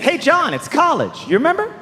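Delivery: high, over-friendly mock greeting; chummy college. College's letter voiced as a pal.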